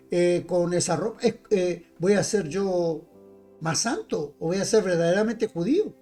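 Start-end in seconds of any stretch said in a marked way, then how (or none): none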